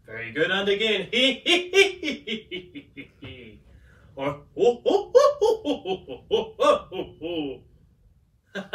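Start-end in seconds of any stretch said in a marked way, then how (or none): 1.1-3.5 high giggling hee-hee-hee from the belly; demonstrated high laughter, tailing off; staged hee-hee laugh. Shown as a demonstration. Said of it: "let's do some laughter". 4.5-8.6 staged ho-ho laugh. Shown as a demonstration. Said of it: "let's do some laughter".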